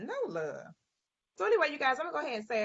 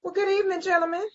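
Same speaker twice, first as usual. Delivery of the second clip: bright, loud, high welcome to the guests; excited greeting; 9 semitones higher, 7 dB louder, faster than usual. Real, not acted. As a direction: greet them big and loud.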